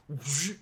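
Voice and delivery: loud mouthed whoosh; rushing vocal sound effect; materializing whoosh. Retold or imitated. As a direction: imitate someone teleporting in. Imitating someone materializing from flash.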